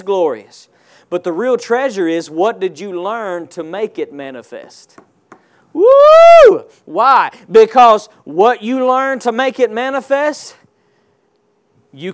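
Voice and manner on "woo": high, loud "Woo!"; a sudden joyful holler; excited whoop. Real, not acted. Preacher whoops to the congregation, high and loud. Preacher exclaims mid-sermon.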